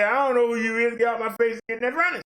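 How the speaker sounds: a lightly voiced Jesus sending sinners off; Jesus dismissing them. Jesus turning away false preachers, stern and curt. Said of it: "Jesus gonna say".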